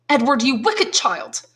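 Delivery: put-on mother scolding her wicked child; scolding cry. A narrator's reading. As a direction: cry out at the naughty boy. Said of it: "cried Madame de Villefort".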